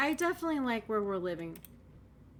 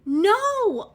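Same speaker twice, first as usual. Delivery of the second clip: stunned; 10 semitones higher, 12 dB louder than usual. Real, not acted. Stunned at the find.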